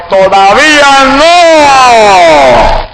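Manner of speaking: high, very slow, loud booming command; God's thundering shout. Retold or imitated. God's voice shouting at the startled man, thundering. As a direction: boom it out like thunder from heaven. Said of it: "y la voz me gritó".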